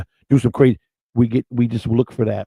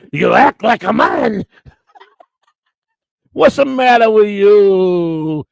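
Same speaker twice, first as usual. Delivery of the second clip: slow, higher, louder mobster scolding through laughter; Don Corleone impression; 9 semitones higher, 5 dB louder, much slower than usual. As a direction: scold like a godfather figure, slowly and loudly. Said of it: "Don Corleone needs to give".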